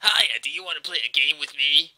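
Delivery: loud, fake-friendly cartoon voice; too-eager kids' mascot, projected loudly; friendly, childlike game voice. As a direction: chirp it like an off Sonic imitation. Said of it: "it spoke in a friendly, almost childlike manner".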